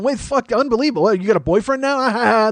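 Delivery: high jeering voice with a laugh; mock teasing. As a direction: imitate friends ribbing him. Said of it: "They're busting my balls".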